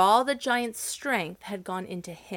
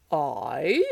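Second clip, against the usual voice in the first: louder, slightly lower giant voice; lightly voiced giant; 4 semitones lower, 5 dB louder than usual. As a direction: answer with giant weight. Giant answers.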